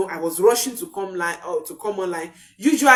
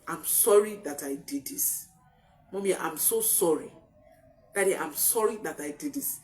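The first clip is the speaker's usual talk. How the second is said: put-on child's apology; 2 semitones lower, 10 dB quieter than usual. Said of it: "you will hear them say".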